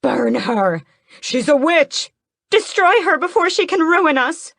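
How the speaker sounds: high, loud shrieking cry to burn a witch; angry mob shout. Acted. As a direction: scream for blood, rising in pitch. Villagers turn on the heroine.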